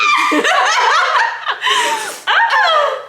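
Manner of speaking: loud, high cackle; squealing belly laugh; uproarious laughter. Real, not acted. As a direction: burst out laughing long and hard. Bursts out laughing about taxes.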